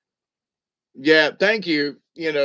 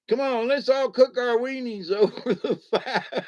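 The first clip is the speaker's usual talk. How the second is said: mock camper's call breaking into laughter; playful high call, laughing; 8 semitones higher than usual. Calling all to cook over the fire, laughing mock call. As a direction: call out high, then crack up.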